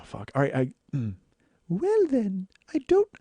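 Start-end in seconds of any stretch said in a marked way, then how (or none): 1.7-3.1 high breathy starlet voice; Marilyn Monroe voice. Acted. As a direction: coo it like Marilyn. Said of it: "I'm not sure I can do Marilyn Monroe's voice".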